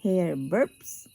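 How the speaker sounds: usual speaking voice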